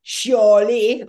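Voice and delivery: "chiali" in a regional French accent, loud, rounded "shaw-lee"; speaker's own French accent. Shown as a demonstration. Explaining her way of saying it, loud accented French "shaw-lee". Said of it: "maybe the way I say it".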